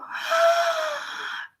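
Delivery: sharp high gasp of shock. Real, not acted. A woman hearing her date has a girlfriend, shocked gasp. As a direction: gasp as if hit by the news.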